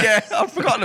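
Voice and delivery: words high through real laughter; amused laugh-talk. Real, not acted. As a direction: laugh while remembering. Laughing at the story.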